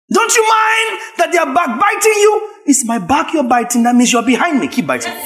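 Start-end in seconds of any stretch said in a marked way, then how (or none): 0.1-2.5 mocking critic. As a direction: needle him like a gossiping critic. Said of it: "mocking critics".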